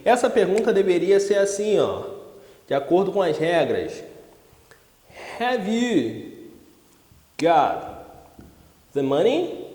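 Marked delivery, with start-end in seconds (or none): 5.4-9.6 spaced out with long pauses between chunks; word by word. Shown as a demonstration. Teacher showing students the rule-book question, word by word. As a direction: dictate it piece by piece, pausing after "you" and "got". Models the correct question while writing.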